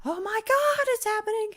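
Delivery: very high, hammed-up excitement; mock panic. Host telling viewers it's happening, high-pitched mock panic.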